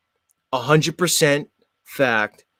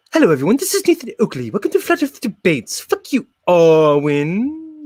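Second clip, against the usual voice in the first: mocking Nathan Oakley, pitched higher, in a put-on host voice; Nathan Oakley impression; 6 semitones higher than usual. Streamer mocks Nathan Oakley for viewers, hammed-up higher host voice. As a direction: ham up a rival host's welcome and insults, higher. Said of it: "Z does his best Nathan Oakley impression".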